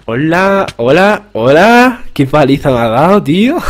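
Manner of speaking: incredulous, rising high; stunned. Real, not acted. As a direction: laugh in disbelief at being thrashed. Stunned at taking a beating.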